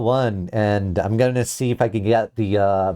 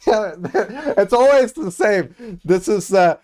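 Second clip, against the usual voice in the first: mock copied YouTuber voice, pitched up; copied speech voice; 8 semitones higher, 4 dB louder, slower than usual. Streamer half-jokingly doing a borrowed speech voice. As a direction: imitate someone else's speaking voice, raised. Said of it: "I'm copying someone else's speech voice".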